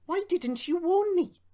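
very high, loud complaint; high-pitched accusing question; indignant protest. A narrator's reading. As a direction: demand an answer in a high, aggrieved voice. Hurt engine confronts culprit.

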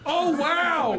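high, loud fake awe; mock amazement. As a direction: gasp out an overblown wow. Mock awe at spoiler card.

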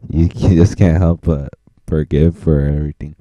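usual speaking voice

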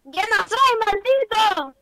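high, loud outburst of protest; indignant shout. Real, not acted. Boy mistaken for a girlfriend, indignant shout. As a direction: yell the denial back at him. Child angrily denies being the girlfriend.

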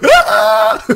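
put-on high-pitched child's shriek; child scream. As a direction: shriek in terror like a small kid. Kid screams.

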